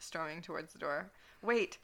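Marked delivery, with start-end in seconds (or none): 1.4-1.8 louder, higher call; yelling. Acted. Edward yells after Draco to stop him. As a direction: call him back, urgent. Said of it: "Edward yelled".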